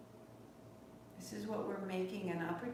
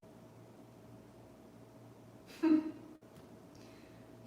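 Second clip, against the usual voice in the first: breathy teary laugh, half a sob; tearful laugh; 5 semitones higher, 4 dB quieter than usual. Real, not acted. Teacher admitting to class she hasn't been home, teary laugh. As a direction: laugh through rising tears. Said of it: "I'm gonna cry as I say it".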